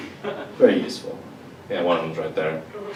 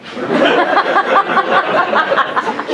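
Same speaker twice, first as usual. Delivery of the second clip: high unvoiced burst of laughter; real laugh; breathy laugh. Real, not acted. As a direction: crack up at the joke. Laughing at own punchline.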